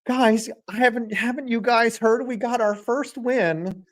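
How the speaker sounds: put-on meek, high voice. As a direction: pipe up timidly, pitched high. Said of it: "that meek voice".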